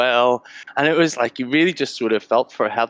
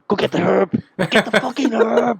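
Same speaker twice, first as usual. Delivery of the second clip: urgent, higher; 8 semitones higher than usual. Retold or imitated. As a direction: pitch climbing with impatience. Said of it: "so I was like".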